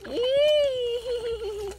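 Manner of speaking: squealed eee; high disgusted squeal. Real, not acted. Reacting to dog eating.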